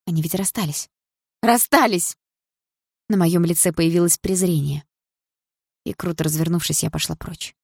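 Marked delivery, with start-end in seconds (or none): 1.4-2.2 higher, sharper insistence; indignant exclamation. A narrator's reading. Jealous girl insisting to herself they split, indignant. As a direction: throw it out in protest.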